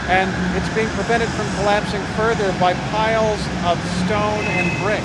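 loud field report over street traffic. Real, not acted. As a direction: project over the traffic noise.

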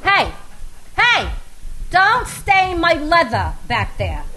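loud, high barked order; barking. A narrator's reading. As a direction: bark it at the back seat. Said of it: "Marina would bark".

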